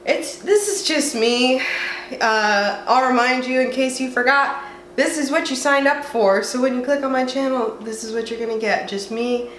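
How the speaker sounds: ordinary delivery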